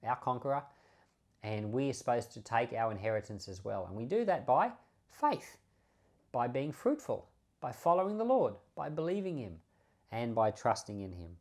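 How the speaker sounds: ordinary delivery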